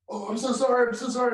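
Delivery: high, loud flustered apologizing; acted startled pleading; panicked apology. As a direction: blurt it out as if caught by police. Retold startled apology to a cop.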